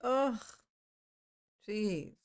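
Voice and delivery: normal talking voice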